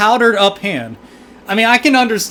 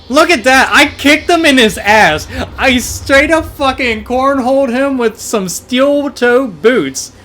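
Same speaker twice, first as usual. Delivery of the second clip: loud, higher-pitched gamer celebration; gloating shout; 5 semitones higher, 3 dB louder than usual. Real, not acted. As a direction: crow over your victory, loud and high. Gloating over kick in game.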